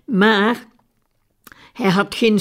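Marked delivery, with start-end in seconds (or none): none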